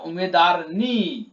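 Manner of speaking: slow উমেদার নি with নি leaned on and falling; stress on নি. Shown as a demonstration. Teacher showing the suffix, slow with stress on নি. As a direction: draw out উমেদার নি, pressing নি. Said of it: "নয় সে নি জুড়ে দাও".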